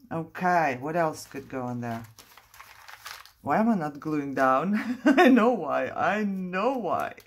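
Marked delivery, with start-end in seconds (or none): 3.4-7.1 laughing. Real, not acted. Crafter admits why she stalls on gluing, laughing. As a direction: laugh through the admission, louder.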